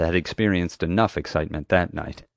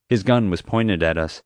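ordinary delivery

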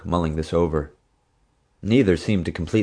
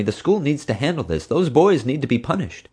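Mom; 9 semitones higher than usual. A narrator's reading. Mom insists on punishment.

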